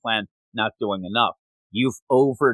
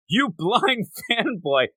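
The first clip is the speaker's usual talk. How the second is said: laughing tease; 8 semitones higher, slower than usual. Retold or imitated. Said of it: "he also goes".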